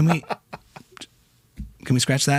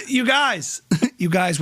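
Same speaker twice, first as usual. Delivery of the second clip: ordinary delivery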